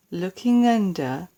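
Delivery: plain reading voice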